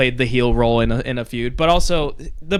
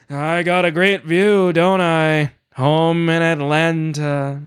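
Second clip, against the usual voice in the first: Cody impression; 3 semitones higher, much slower than usual. Host doing Cody's slow drawl for the co-hosts. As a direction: drag it out slow like a groggy wrestler. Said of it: "did I get it? Did I nail it?".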